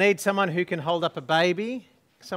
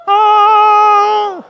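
high loud sung ah; a bright choir-like ah, high and loud; angel ah; 12 semitones higher, 12 dB louder than usual. Shown as a demonstration. Angel prompt during story.

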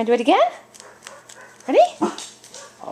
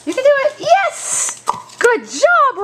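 squeaky praising a dog; 10 semitones higher, slower than usual. Real, not acted.

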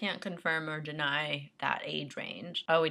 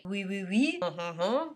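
bad French accent; 3 semitones higher than usual. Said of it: "my French accent sucks".

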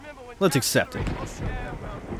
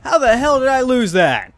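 exasperated repeat; 9 semitones higher than usual. Real, not acted. Lost duel, disbelief.

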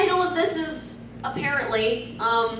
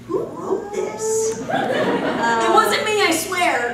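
higher, laughing denial; mock innocence; 6 semitones higher, 4 dB louder, slower than usual. Real, not acted. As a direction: play innocent through giggles. Joking denial of authorship.

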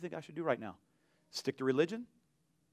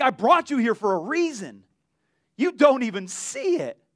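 raised, louder, stretched at the end; imitated exasperated rebuke; 5 semitones higher, 5 dB louder than usual. Jesus rebuking his blind disciples, imitated exasperated rebuke. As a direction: act out scolding them for missing the point.

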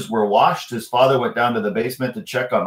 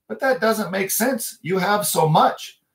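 put-on puzzled boy; 9 semitones higher, slower than usual. Michael arguing with leprechaun.

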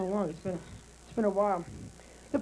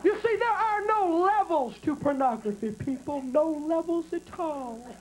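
Jimmy Swaggart sermon. Retold or imitated. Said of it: "Jimmy got his legs spread wide open".

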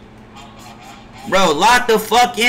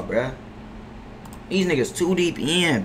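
usual speaking voice

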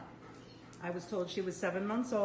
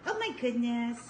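ordinary talk, nothing marked

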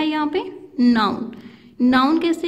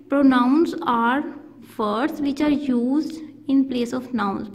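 slow dictation; much slower than usual. Shown as a demonstration. Teacher repeating definition for dictation.

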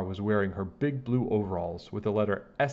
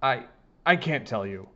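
usual speaking voice

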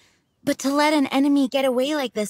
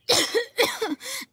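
weak cough; 12 semitones higher than usual. Acted. Jean is weak and unwell.

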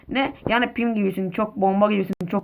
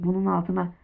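ordinary talk

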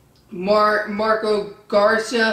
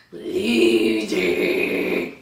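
mocking deep loser; 11 semitones lower than usual. Real, not acted. Repeats insult, deep and drawn.

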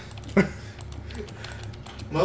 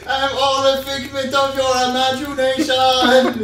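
laughing; 9 semitones higher, 8 dB louder than usual. Real, not acted. Joking through laughter.

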